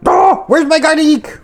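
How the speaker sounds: high, loud, slow panicked cry; alarmed about the missing garlic. Real, not acted.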